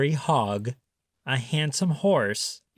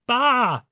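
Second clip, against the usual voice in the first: high, louder bleat; sheep baa; 10 semitones higher, 5 dB louder than usual. A narrator's reading. Reading to children, a loud high sheep bleat. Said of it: "said the sheep".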